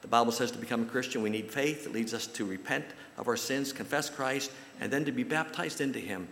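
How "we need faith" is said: normal talking voice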